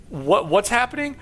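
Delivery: high-pitched, halting confused question; acted bewilderment. Player to a robot that thinks it's human, bewildered.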